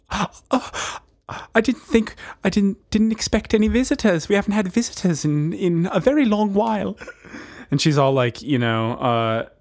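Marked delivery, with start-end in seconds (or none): 0.1-7.0 high, flustered British caretaker; hammed-up apologetic fluster; frazzled caretaker. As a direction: put on a high voice, thrown by unexpected visitors. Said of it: "this one caretaker who's, like, extremely frazzled".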